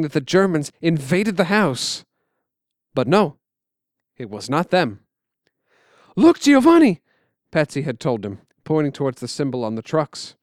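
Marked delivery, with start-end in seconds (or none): 6.1-7.0 much higher, louder call; excited call. Acted. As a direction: call out to your brother. Boy calling to brother.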